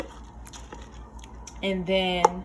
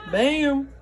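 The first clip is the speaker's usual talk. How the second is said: normal talking voice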